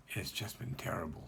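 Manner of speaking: breathy hushed whisper of despair. Real, not acted. Rabbi confiding to listeners, whispering. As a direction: whisper it as if worn out. Telling of an awful week.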